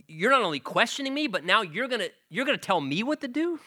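pitched high, a God incredulous at being ordered about; indignant God. Retold or imitated. God confronting a person who tries to command him, high and incredulous. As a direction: rebuke the upstart as God, rising in disbelief.

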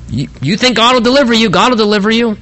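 high, quick mock assurance; totally sarcastic. Prophet mocks the king's hope of deliverance, sarcastic. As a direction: toss back his belief sarcastically. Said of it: "he's just being totally sarcastic".

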